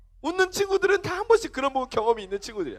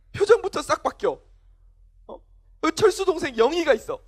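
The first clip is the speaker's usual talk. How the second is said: ordinary delivery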